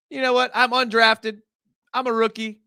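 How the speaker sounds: hyped rookie. Pretend. Voicing the rookie's hype.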